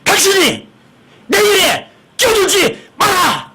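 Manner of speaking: husband snapping in high, drawn-out shouts; irritated outburst. Acted. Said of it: "신경질 확 내면서". (신경질을 확 내는 남편의 높고 느린 호통)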